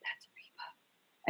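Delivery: hushed unvoiced whisper, slow. Telling Sam beside the bananas that it's Reba, whispered. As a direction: breathe it so only he hears, slowly.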